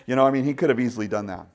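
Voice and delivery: normal talking voice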